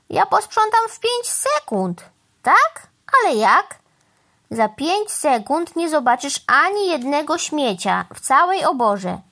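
a hint of a cocky crow showing off; boastful crow. Crow promises the cow a spotless barn, boastful.

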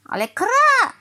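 very high, loud squawk; crow caw. A narrator's reading. Crow answering the finished-house boast, loud squawk. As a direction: break into a protesting caw.

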